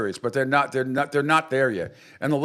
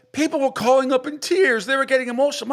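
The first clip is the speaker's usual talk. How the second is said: mocking Clay Travis; 10 semitones higher than usual. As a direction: ham up the host's sob story. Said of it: "He's like".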